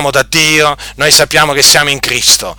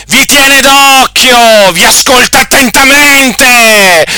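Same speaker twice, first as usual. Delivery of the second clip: high, loud pulpit denunciation; fiery preaching; 9 semitones higher, 4 dB louder, slower than usual. Acted. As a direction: thunder at them from the pulpit. Preacher warning the listeners.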